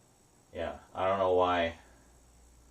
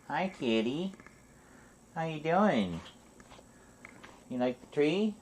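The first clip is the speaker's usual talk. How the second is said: higher pet-talk voice; talking to a cat; 8 semitones higher, 3 dB quieter than usual. Real, not acted. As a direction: coo at the kitty.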